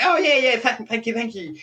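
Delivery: hurried mock thanks, high then fading away; imitating awkward politeness. Couple accepting mistaken wedding congratulations, mock-polite. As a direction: play too British to correct him, starting high and tailing off.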